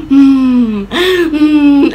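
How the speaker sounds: loud, high mock whimper through closed lips; gasp, then hum out a suppressed sob; stifled crying. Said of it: "I'm all quiet and I'm like".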